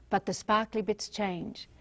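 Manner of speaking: ordinary delivery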